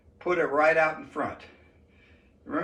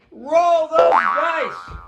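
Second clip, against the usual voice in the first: excited announcer; 9 semitones higher, 6 dB louder, much slower than usual. Acted. Hyping the dice roll.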